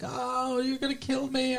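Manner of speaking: small, high, hushed mock whimper; mock fear. Retelling the cornered villain facing his attackers, mock fear. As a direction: plead softly and high, lightly hammed up.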